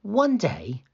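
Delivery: ordinary delivery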